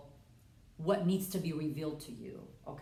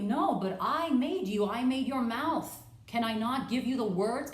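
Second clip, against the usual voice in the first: louder, higher rebuking God; indignant God; 5 semitones higher, 5 dB louder than usual. Acted. God rebuking Moses for his excuses, loud and high. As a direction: scold him for doubting his maker.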